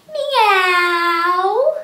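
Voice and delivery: loud rising meow; cat meow. Shown as a demonstration. Teacher showing cat sit-ups with a loud rising meow. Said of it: "cat sit-ups".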